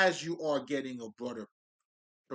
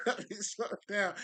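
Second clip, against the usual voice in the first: higher-pitched, amused self-correction; laughing; 8 semitones higher, 8 dB louder than usual. Real, not acted. Speaker catches himself getting carried away, laughing. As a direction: say it through a laugh.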